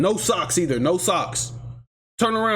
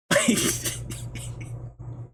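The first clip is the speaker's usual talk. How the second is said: breathy laugh; 6 dB quieter than usual. Real, not acted. Laughs at own story.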